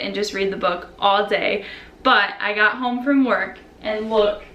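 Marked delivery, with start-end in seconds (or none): none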